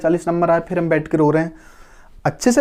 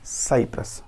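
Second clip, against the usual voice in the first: whispered answer; 8 semitones lower, 9 dB quieter, slower than usual. Shown as a demonstration. Teacher answering her own question to pupils, whispering. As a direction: whisper Cyprus softly as the answer. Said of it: "क्या नाम है?".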